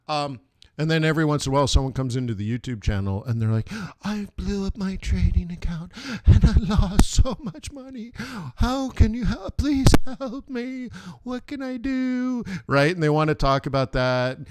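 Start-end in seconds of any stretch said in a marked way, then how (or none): 4.0-12.4 much higher, pleading mock whine; hammed-up whiny plea; whiny desperate viewer. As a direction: beg for help like a panicked trader.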